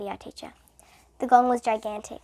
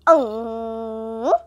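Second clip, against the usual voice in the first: gong; 6 dB louder than usual. A narrator's reading. Gong sound imitated.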